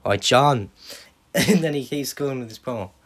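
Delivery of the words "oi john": much louder shouted greeting; loud call. Retold or imitated. Punk calling out to Cena on commentary, loud. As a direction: call across to him. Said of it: "he goes".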